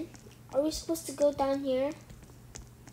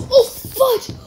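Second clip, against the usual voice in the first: startled laughing yelp; 8 semitones higher, 10 dB louder than usual. Real, not acted. Startled by a jump scare.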